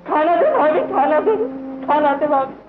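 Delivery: high, loud repeated wail; loud begging cry. Acted. Brother begging sister-in-law for food, loud begging cry.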